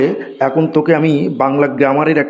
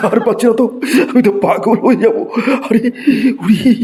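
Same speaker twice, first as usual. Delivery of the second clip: higher, laughing despair; laughing exasperation; 7 semitones higher than usual. Acted. Teacher laughing in despair at a cheeky pupil. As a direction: laugh helplessly at the student's answers.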